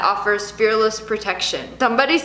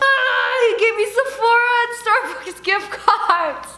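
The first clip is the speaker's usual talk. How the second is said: delighted squeal; 9 semitones higher, slower than usual. Real, not acted. Gift card delight.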